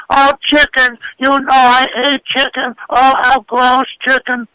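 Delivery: put-on high, whiny complaining kid voice. Dad mimicking his kid who rejects dinner, high whiny voice.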